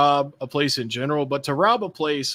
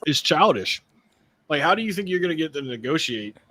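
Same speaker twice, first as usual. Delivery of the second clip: ordinary delivery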